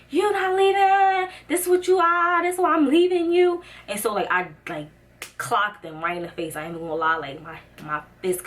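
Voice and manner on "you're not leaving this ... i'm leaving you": schreeuwend van woede, veel hoger en harder; retold furious yell. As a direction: roep het uit vol woede naar je ex. Screaming at ex in car.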